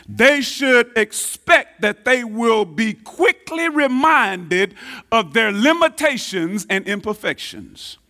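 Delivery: usual speaking voice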